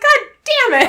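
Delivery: very high, loud hammed-up curse; mock frustration. Proposer learning he never gave his speech, loud mock frustration. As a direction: curse in comic exasperation.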